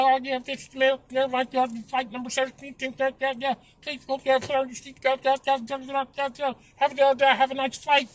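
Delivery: high, soft, garbled phone announcement; mumbling flight attendant. Retold or imitated. Mocking the flight attendant's cabin announcement, mumbled and garbled. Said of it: "can't understand what the fuck they be talking about".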